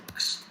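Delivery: "x" as a bare "ks" consonant cluster; "x" as an isolated "ks"; ks sound demo. Shown as a demonstration. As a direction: say "x" as k plus s, not the letter name. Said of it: "going to be pronounced as".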